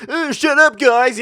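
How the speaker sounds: mock retort. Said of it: "if I just retaliated normally".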